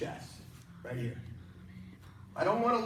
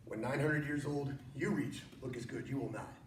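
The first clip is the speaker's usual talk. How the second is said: low, hushed Yoda impression; lightly voiced wise old Yoda; 5 semitones lower, 7 dB quieter than usual. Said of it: "Grandmaster Yoda".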